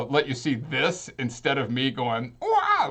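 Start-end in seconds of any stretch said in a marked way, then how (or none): 2.4-2.9 sudden very high silly wah noise; a goofy mouth-noise yelp; monkey-like wah. Shown as a demonstration. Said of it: "looking like a monkey trying to make some noises".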